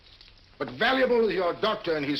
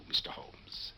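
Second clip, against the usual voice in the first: whispered threat, much softer; menacing whisper; 9 dB quieter, slower than usual. Acted. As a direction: breathe the name like a threat. Villain threatening to kill Holmes.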